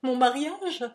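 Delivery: loud, high indignant question; outraged outburst; interrupting cry. A narrator's reading. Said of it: "l'arrêta d'un cri".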